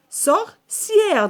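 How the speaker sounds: high sor... cière, split in two; syllable-by-syllable. Shown as a demonstration. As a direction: pitch up and separate sorcière's syllables. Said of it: "فين كنسمعوا le son A".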